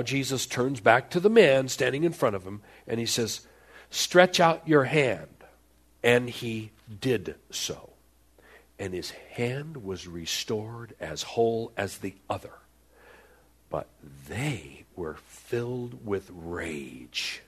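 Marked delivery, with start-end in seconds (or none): 3.9-5.3 firm, slow command, louder; slow, loud commanding Jesus. Acted. 13.7-17.4 low, slow and soft; slow, low ominous narration. A narrator's reading. Preacher darkens on rage.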